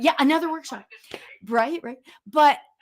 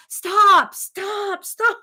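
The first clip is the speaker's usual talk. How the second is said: put-on toddler pleading; 8 semitones higher, 8 dB louder, much slower than usual. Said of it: "She's like".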